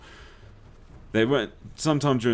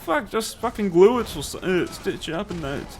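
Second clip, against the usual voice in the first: just fix the thing, fed up with waiting; exasperated outburst; 10 semitones higher than usual. Retold or imitated. Fed-up patient tells doctor to act.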